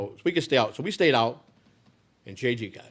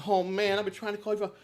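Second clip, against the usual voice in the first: desperate phone call; 10 semitones higher than usual. Retold or imitated. J J calls from the hospital.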